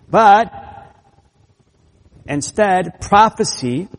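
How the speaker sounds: loud, high, with long pauses between phrases; emphatic. Retold or imitated. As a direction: drive each contrast home, punching SIGN, BUT and PROPHECY. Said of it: "it's a very emphatic".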